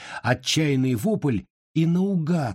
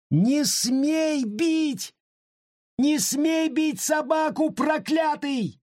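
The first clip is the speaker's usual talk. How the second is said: put-on very high, slow, shrill childish cry; desperate yell; much slower than usual. A narrator's reading. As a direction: scream it out to save the dog. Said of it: "закричал в выступлении Сергей".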